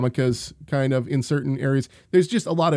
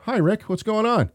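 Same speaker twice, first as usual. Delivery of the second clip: surprised greeting; 9 semitones higher than usual. Retold or imitated. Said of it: "I open the door. It's Rick".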